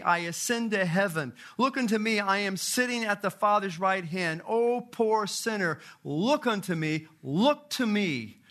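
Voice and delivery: high, loud, rousing street-preacher voice; fervent lay preaching. Retold or imitated. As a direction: preach it to the crowd, building with every look. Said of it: "the preacher began by saying".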